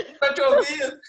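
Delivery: high, loud mock complaint with laughter; retold student shout. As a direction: shout it as an exasperated kid, laughing. Students yelling at the guide.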